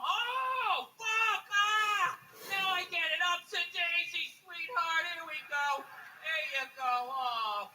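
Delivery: high, loud mock-crying realization, then soothing; mock epiphany. Wife beater watching the show, crying out in mock realization. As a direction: sob out a sudden realization. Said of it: "wife beaters watching".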